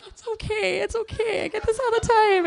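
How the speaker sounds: high, tearful mock reassurance; imitated bawling. Teen reassuring the cop, mock bawling. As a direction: squeak it out as if holding back tears. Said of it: "bawling, you know".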